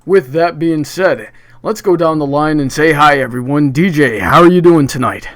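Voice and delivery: usual speaking voice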